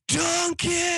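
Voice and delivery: very high, drawn-out retold scream. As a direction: scream it like a fan urging on a player. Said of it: "And I'm screaming".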